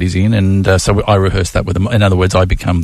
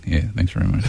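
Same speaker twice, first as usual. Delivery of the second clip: low-key mumble; 3 semitones lower, 7 dB quieter, faster than usual. Retold or imitated. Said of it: "Red light went off, bang".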